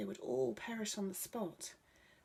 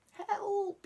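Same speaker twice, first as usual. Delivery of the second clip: a very high, loud yell for help; cry for help; 7 dB louder than usual. Acted. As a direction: scream it as you fall in the water. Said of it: "he cried".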